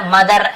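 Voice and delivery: "मदर" with a full final r; final r pronounced. Shown as a demonstration. Teacher showing what not to say, "मदर" with final r sounded. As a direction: end "मदर" on a clearly sounded r. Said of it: "ऐसे र को पूरा नहीं बोलते".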